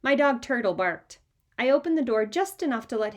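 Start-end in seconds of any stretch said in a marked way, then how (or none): none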